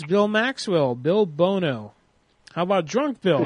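ordinary talk, nothing marked

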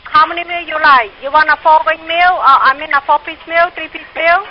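ordinary delivery

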